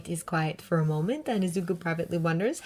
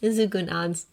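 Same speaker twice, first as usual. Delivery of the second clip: usual speaking voice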